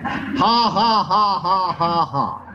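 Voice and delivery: evenly spaced, put-on "ha ha"; spiritless forced laugh. Shown as a demonstration. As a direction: fake a laugh with little spirit. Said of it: "about how much spirit it had".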